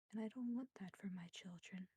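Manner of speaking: soft, breathy whisper, fast on the first line; tender whisper. Acted. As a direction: whisper like a worried mother. Motherly spirit comforts crying boy.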